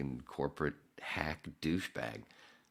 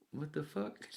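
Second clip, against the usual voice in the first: surprised what the fuck; 12 semitones higher, 3 dB quieter than usual. Retold or imitated. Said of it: "And they're in there going".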